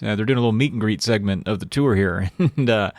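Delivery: usual speaking voice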